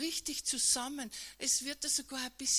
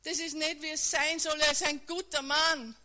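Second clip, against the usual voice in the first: higher, louder protest against injustice; inner outcry; 5 semitones higher, 6 dB louder than usual. Acted. Listener silently crying out as Jesus carries the cross, raised anguished voice. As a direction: cry out in despair inside. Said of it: "in deinem Kopf schreist du".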